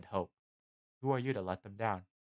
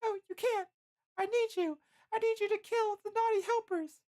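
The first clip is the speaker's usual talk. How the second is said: very high falsetto protest; protesting Licia; 4 dB louder than usual. A narrator's reading. Licia refuses to abandon Bill, protesting falsetto.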